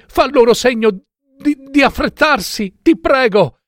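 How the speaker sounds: put-on high, urgent, pleading young wife. Wife begs her sister to climb the tower, pleading. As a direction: beg urgently in a high-pitched voice.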